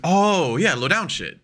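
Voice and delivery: high drawn "oh" of catching on; mock realisation. Everybody reacting to the wrestler's hint, mock realisation. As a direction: let the penny drop, pitch swooping up.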